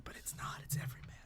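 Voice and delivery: hushed, voiceless spoiler aside; whispered correction on the side. Real, not acted. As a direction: drop to a whisper for a quick secret.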